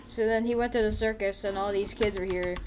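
ordinary talk

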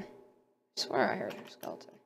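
hushed, slow whisper so the skeleton won't hear; scared whisper in a dark cave. Real, not acted. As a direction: breathe it out, barely voiced. Hearing a skeleton nearby.

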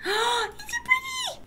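breathless wonder; awed gasp. Real, not acted. Player spotting something lovely, awed gasp.

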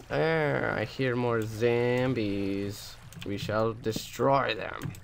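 slow, drawn-out put-on proclamation; mock-heroic voice. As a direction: stretch it out like a grand declaration. Mock-grand announcement in put-on voice.

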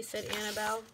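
ordinary delivery, nothing marked

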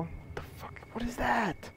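unvoiced scared whisper; frightened whisper. Real, not acted. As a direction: whisper it in fear.

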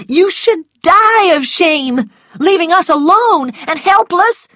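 high, loud scolding; outraged reproach. A narrator's reading. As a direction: lash out at him, pitch way up. Scarlett rebukes Rhett for leaving.

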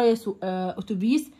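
normal talking voice

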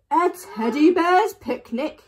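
big loud delighted bellow; bellowing bear. A narrator's reading. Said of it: "he bellowed".

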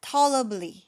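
"tolerably" loud and high at TALL, falling to a final blee; corrected ending. Shown as a demonstration. Teacher fixes the forgotten ending, corrected ending. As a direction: punch the start, end "tolerably" on lee. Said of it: "อันนี้มันเป็น ly ใช่ไหม".

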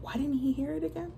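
ordinary delivery, nothing marked

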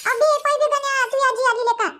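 put-on a high, far-off child's voice calling to a friend; calling out a warning. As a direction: crie comme un gamin qui prévient son copain.

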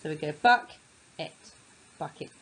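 buck and et said apart, then blended into bucket; split, then blend; sounding out. Shown as a demonstration. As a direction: phonics teacher sounding out bucket. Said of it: "separate after".

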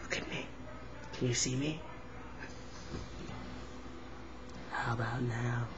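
soft hidden whisper. Pretend. Boy pretending to disappear, quiet whisper. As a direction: whisper as if vanishing from view. Hiding, playing disappearing act.

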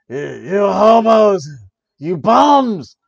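loud, high mock shout; hammed-up yell at the bums; yelling insults. Yelling insults at imaginary protesters.

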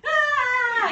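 loud, high group-panic shriek; hammed-up scream of horror; mock terrified scream. Everyone screams on seeing Rasputin.

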